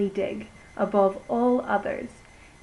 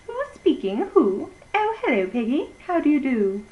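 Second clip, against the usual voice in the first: put-on much higher girlish phone voice; 5 semitones higher, 4 dB louder than usual. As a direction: chirp into the phone like a young girl.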